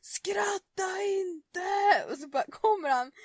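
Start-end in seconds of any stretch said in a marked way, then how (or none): 0.0-2.1 mock shout, higher and very drawn out; hammed-up brother yelling; imitated yell. Brother yelling at his sister to stop laughing, mock shout. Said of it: "Hampus bara".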